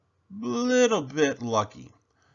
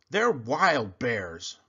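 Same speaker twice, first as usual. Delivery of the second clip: slow, lightly voiced bully; bully insisting; 3 semitones higher, much slower than usual. A narrator's reading. Bully Sammy insisting to Kevin. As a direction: insist it, drawn out.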